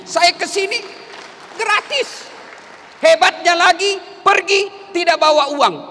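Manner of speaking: loud, high, rousing sermon with shouted peaks; full-force preaching. Acted. Preacher exhorting students to study hard. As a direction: drive the lesson home to the cadets.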